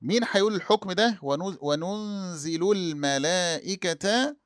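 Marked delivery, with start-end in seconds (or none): 1.2-4.4 وننزل الملائكة drawn out slowly, wanunzilu l-malā'ikata; careful teacher's reading of وننزل الملائكة; slow recitation demo. Shown as a demonstration. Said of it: "ابن كثير".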